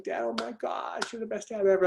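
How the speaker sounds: high-pitched imitation of a thrilled daughter; excited, happy. Daughter thanking dad for concert tickets, excited. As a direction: gush it high, as the overjoyed daughter. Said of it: "She's so excited. She's so happy".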